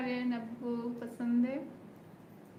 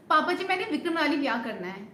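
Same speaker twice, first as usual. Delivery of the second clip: fast, pleading Punjabi plea to father; rushed film line; 2 semitones higher, 5 dB louder, faster than usual. Acted. Streamer rushing the film dialogue, fast pleading. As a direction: rattle off the heroine's plea. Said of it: "इतनी speed में बोल रहा है dialogue".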